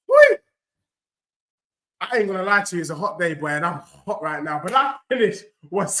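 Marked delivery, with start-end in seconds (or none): 0.1-0.4 short, loud, high-pitched "whee"; piercing little cry of excitement; high whoop. Real, not acted. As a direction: let out a sudden high whoop. Reacting to the match.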